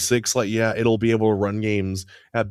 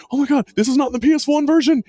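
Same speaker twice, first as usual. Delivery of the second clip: high, loud, fast panicked cry; freaking out; 6 dB louder, faster than usual. Retold or imitated. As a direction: imitate a friend losing it, voice shooting way up. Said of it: "he was, like, freaking out".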